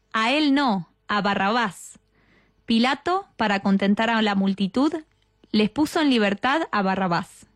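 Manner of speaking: measured, melodic Gospel reading; scripture reading. Acted. Announcing the second mystery to those praying, solemn scripture reading. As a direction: read the Passion aloud with lifted, deliberate phrasing.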